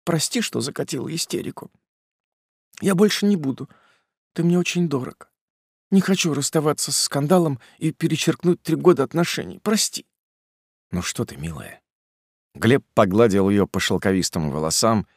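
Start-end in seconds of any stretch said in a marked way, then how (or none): 0.1-10.1 put-on higher, gentle young woman; lightly voiced young woman; female character voice. A narrator's reading. Polina apologizes. 10.9-11.8 soft, low and gentle; tender. A narrator's reading. Gleb comforts her.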